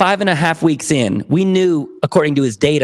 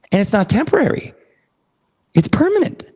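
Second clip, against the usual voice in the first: thrilled disbelief, rising high; excited; 8 semitones higher, faster than usual. Retold or imitated. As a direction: voice leaping up on permanent. Client's joyful realization retold.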